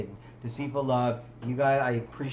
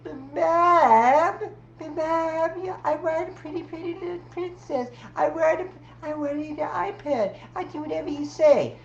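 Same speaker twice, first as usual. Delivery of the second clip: put-on high, whiny child voice, pleading with Mom; whiny little kid; 13 semitones higher, 5 dB louder than usual. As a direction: beg like a spoiled kid. Kid begging his mom.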